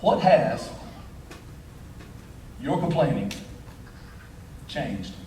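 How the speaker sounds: low and drawn out, long pauses; grave, halting question, pitch falling away; solemn closing question. Real, not acted. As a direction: let each phrase sink in, dropping softly at the end. Solemn closing question, later repeated raised.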